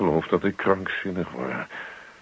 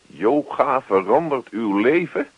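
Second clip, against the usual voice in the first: ongelovig herhaald; 6 semitones higher than usual. Acted. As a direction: throw the headline back in disbelief. Henk echoes in disbelief. (hoog en ongelovig nagezegd)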